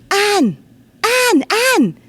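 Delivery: put-on high, loud child's voice calling Anne in a Scots accent; wee Scottish boy. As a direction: call out like an excited wee Scottish lad tugging at her. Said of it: "I'm going to do it in the Scots dialect".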